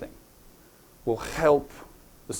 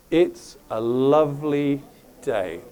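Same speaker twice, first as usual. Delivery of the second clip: very slow, drawn-out words; half speed; slower than usual. Shown as a demonstration. Teacher demonstrating slowed speech to practise, half-speed delivery. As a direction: stretch each word out at half speed. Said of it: "Say it half the speed".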